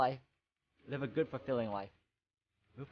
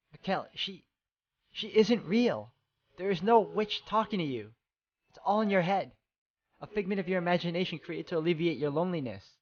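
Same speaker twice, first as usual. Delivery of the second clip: desperate insisting; 7 semitones higher than usual. Acted. Giselle begging Mikkel to see the witch isn't real, urgent pleading. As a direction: make him believe it, pressing hard.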